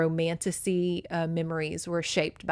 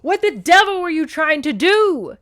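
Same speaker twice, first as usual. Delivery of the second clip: high, loud outraged shout; furious cry; 10 semitones higher, 10 dB louder than usual. A narrator's reading. Derek thrusts her away, furious shout. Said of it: "he cried".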